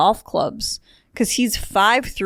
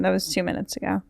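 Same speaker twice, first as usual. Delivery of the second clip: ordinary delivery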